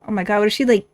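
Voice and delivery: ordinary talk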